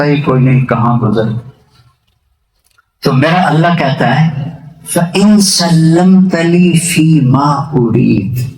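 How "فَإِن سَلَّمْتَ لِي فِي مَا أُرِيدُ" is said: Quranic recitation. Acted. Quoting divine words again.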